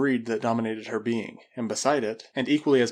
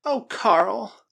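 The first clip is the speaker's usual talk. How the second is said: high, loud appeal; desperate cry; 10 semitones higher, 7 dB louder than usual. A narrator's reading. Girl turns to the Russian, high desperate cry. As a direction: call to him in distress. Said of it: "she cried".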